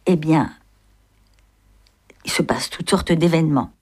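measured, expressive reading of a classic novel; story narration. A narrator's reading. A reader telling the novel's night escape, in an expressive narrating voice. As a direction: read the chapter aloud, letting the scenes breathe.

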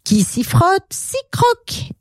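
high-pitched playful chant; gleeful storybook pair; joyful cry. Acted. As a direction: cry out the motto, leaping up in pitch. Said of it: "Et ils criaient".

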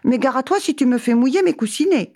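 high, prim cat warning; high lightly voiced prissy cat; fussy cat. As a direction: warn the dog like a touchy cat. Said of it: "dit le chat".

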